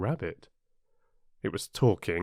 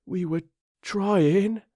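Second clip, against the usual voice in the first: slow, high, pleading explanation; very humble; 10 semitones higher, 3 dB louder, much slower than usual. A narrator's reading. Gardener Two on one knee to the Queen, humble pleading. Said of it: "in a very humble tone".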